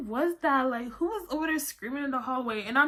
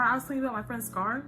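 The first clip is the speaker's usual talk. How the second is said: usual speaking voice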